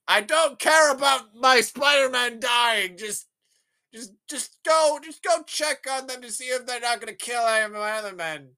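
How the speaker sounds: high, loud hammed-up scolding; mock exasperated boss. Boss scolds underling in retelling.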